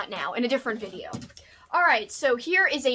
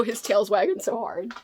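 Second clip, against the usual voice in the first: normal talking voice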